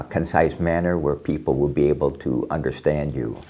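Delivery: ordinary talk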